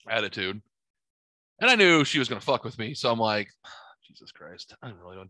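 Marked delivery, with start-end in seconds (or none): 4.1-5.3 sighed, low, very quiet grumble; sigh, then say it low under your breath; resigned, half-voiced muttering to oneself; weary aside. Weary aside before dealing with boss.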